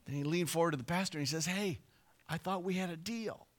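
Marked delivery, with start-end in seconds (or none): 1.5-3.5 hushed aside. Retold or imitated. Groom leans in to pastor.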